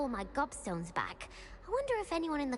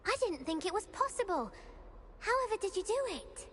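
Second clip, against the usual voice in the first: higher, eager disbelief; can't believe her luck, pitch up; delighted surprise; 8 semitones higher than usual. Acted. Schoolgirl hearing her gobstones were all found, surprised.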